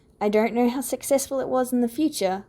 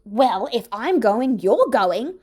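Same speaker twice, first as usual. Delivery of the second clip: louder, slow retort; snapping back; 5 dB louder, slower than usual. A narrator's reading. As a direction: throw it back at him. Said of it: "Oboro snapped back".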